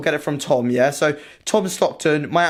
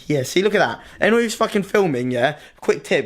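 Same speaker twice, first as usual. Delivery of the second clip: ordinary talk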